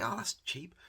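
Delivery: put-on a kid delighted at the price; imitating kid. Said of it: "And they say".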